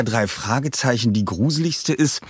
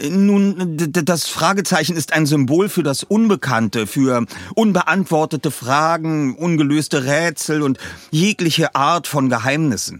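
put-on higher, eager boy's voice; young Justus explaining; 6 semitones higher than usual. A narrator's reading. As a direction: stammer at first, then explain proudly.

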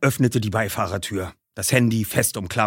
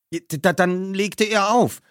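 stammering nervously; 10 semitones higher than usual. A narrator's reading. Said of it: "fuhr Peter fort".

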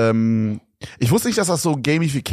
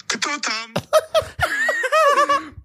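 high laughing burst. Real, not acted. Laughs at app rating.

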